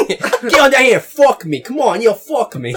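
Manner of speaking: high, loud mock Southern call; hammed-up Southern come-on, half laughing; Southern accent. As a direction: holler it in a put-on Southern accent. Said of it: "all of a sudden accent".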